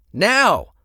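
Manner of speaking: high, loud barked order; shouting. Acted. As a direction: snap them into action.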